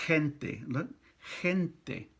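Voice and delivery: gente with a breathy h start, ente; Spanish g as h. Shown as a demonstration. Teacher showing learners Spanish gente, breathy h. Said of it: "G E by itself is pronounced je".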